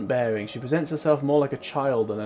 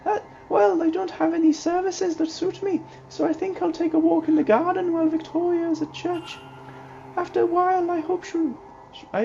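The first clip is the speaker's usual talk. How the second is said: high, chirpy and innocent; high girlish young lady. Acted. Ivy chatters about her day to Mr Ward, high and giddy. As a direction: speak like a cheerful child-like young woman.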